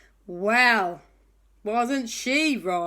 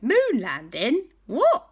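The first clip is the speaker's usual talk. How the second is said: baffled; 6 semitones higher, 5 dB louder, slower than usual. Acted. Boys baffled.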